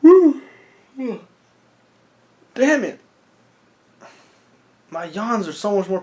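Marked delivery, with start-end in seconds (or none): 0.0-0.4 loud, very high whoop; excited cheer. Real, not acted. Cheering at the action. 2.5-3.0 high, loud frustrated outburst; loud exclamation. Real, not acted.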